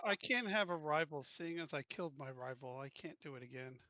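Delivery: ordinary delivery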